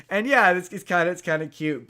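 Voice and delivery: ordinary talk, nothing marked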